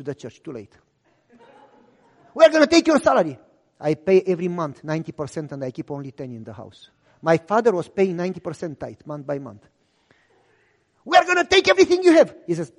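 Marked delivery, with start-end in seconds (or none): 2.3-3.4 high, loud, fast threat; threatening shout. Retold or imitated. Police threatening father's salary, shouting fast. 11.0-12.3 high, loud, fast threat; threatening shout. Retold or imitated. Police threatening to take everything, shouting.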